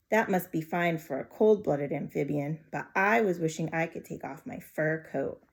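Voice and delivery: usual speaking voice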